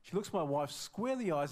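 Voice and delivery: normal talking voice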